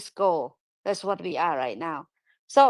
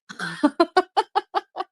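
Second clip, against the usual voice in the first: short high burst of laughter; 9 semitones higher than usual. Real, not acted. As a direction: laugh at yourself. Laughs at own sleepy speech.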